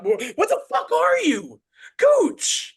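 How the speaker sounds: loud, high imitated rage, rising to a shriek; mock gamer scream; yelling. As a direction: bawl out a missing teammate. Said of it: "yelling at me".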